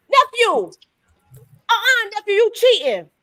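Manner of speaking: high, loud mock accusation; playful mock scolding. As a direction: call out the cheater in a high, loud voice. Playful scolding during hearts game.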